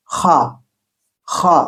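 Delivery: throaty Arabic kha, scraped in the throat. Shown as a demonstration. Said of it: "আমার গলার মধ্যে একটা গড় গড় শব্দ হচ্ছে". (গলার ভেতর থেকে ঘষে খা)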